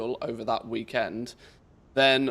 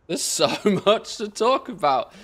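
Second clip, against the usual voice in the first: laughing; 8 semitones higher, 6 dB louder than usual. Real, not acted. Laughs while speaking.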